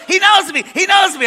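high, loud pleading yell; acted desperate shout. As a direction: call out urgently as if being hauled away. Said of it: "he yells".

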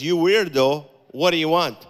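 loud, rude snap at a pushy fan; rude brush-off. Retold or imitated. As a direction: shove the creep away with your voice. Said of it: "That's not how Jesus talks".

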